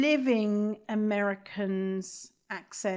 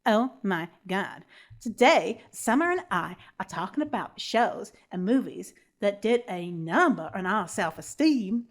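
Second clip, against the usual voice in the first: badly faked American Valley Girl accent. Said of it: "in my other American accent, which is Valley Girl".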